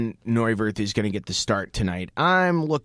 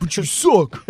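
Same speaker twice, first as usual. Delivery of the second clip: put-on Canadian accent; 5 semitones higher, 3 dB louder than usual. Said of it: "pretty terrible Canadian accent".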